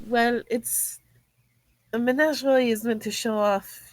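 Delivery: ordinary talk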